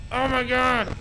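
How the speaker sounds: normal talking voice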